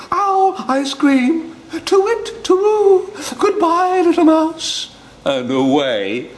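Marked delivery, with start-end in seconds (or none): 0.0-4.9 1 panicked owl. Acted. Owl flees in terror.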